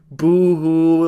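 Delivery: mock her tears with a sneer; mocking boo-hoo. Mocking her in retold rant.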